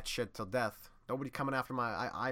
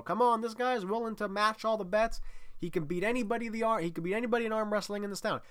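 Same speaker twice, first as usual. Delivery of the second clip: high, fast, eager townsman urging his buddies; excited townsfolk; 9 semitones higher than usual. Retold or imitated. Townsman rallying buddies to bet, high eager rush. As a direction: hurry the pals in, hyped and higher. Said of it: "and they go".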